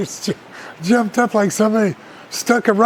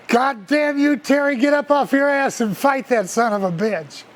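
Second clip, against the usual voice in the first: higher-pitched ringside scolding, imitated; angry father; 7 semitones higher, 3 dB louder than usual. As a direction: holler like a father quoting his fury. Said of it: "I'm gonna quote him".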